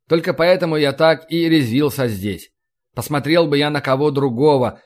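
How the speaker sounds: ordinary delivery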